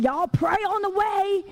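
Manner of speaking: loud, high, drawn-out urging; retold calling out. Worried mother telling travelers to pray, calling out.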